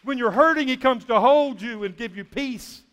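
high, loud, driving preacher delivery; full-force preaching. Real, not acted. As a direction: build to a ringing high pitch, then stretch out the last words.

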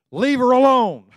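"Leave her alone" barked loud and high; Jesus defending Mary, forceful loud command; stern rebuke. Acted. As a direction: shut him down hard.

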